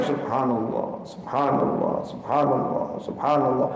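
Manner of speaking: slow hushed recitation, every word meant; deeply felt dhikr. Shown as a demonstration. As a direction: breathe each Subhanallah out gently with full devotion. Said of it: "Dihayati betul".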